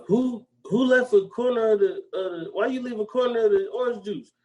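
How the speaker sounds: high, indignant nagging; mock scolding old woman. Retelling the orange juice scene, high mock scolding. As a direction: nag like an offended grandma. Voicing [name] scolding.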